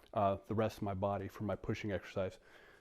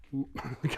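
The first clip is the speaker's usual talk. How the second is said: short laugh. Real, not acted. Laughs while being pulled back.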